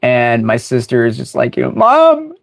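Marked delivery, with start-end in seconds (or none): 1.7-2.3 high-pitched mock whine for Mom; crying child. Little sister pleads with Mom, mock crying. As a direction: imitate an upset kid calling Mom. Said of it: "she's, like, crying".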